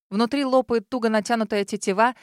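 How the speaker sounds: plain reading voice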